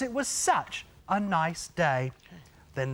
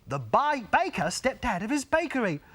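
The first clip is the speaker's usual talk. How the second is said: usual speaking voice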